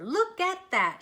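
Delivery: loud, high, slow; excited shout. Acted. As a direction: marvel at the hay. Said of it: "she shouted".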